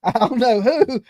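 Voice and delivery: ordinary delivery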